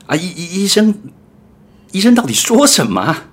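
high, loud panicked stammer; anxious patient shouting up high; panicky patient. Acted. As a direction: beg the doctor in a panic. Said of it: "patient panics for answer".

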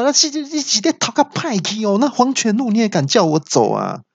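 high panicked cry; yelling in panic. Retold or imitated. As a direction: shriek at the GPS in alarm. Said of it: "就大叫说".

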